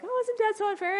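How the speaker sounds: put-on high complaining child's whine; whiny kid. Crying kids complain to mom about dad, in a high whine. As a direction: play the upset kid protesting to mom. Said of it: "they're like".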